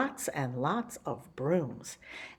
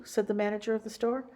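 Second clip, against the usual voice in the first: usual speaking voice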